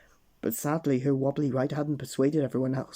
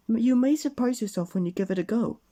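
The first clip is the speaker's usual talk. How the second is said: high, louder coaxing voice; encouraging; 8 semitones higher, 3 dB louder than usual. Acted. Miss Brave encouraging nervous friends, high and coaxing. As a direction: cheer the friends on kindly. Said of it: "continues encouragement".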